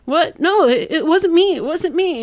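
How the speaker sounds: high-pitched mock panic; panicked denial. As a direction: protest innocence in an imitated high voice. Accused son denies it in panic.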